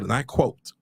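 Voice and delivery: usual speaking voice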